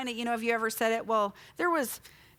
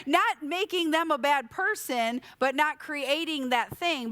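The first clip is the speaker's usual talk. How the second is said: ordinary talk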